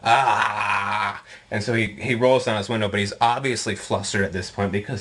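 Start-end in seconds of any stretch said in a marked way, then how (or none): 0.0-1.2 mock chest-out threat; lightly voiced blustering bully; puffed-up tough guy. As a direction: imitate a man puffing himself up. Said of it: "puts on his, like, big chest".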